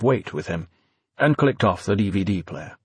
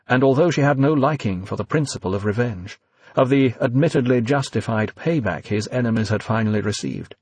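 plain reading voice

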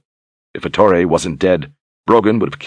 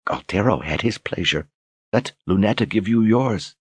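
soft, high seductive coo; 4 semitones higher, 4 dB quieter than usual. Acted. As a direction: coax him sweetly to bed. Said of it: "she cooed intimately".

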